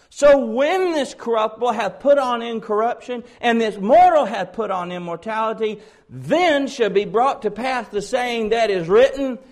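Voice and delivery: high, loud triumphant proclamation; booming climax, slowing at the end; full-force preaching. Acted. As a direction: proclaim scripture at the top of your force.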